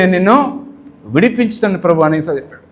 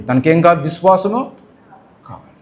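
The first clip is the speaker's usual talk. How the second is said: ordinary delivery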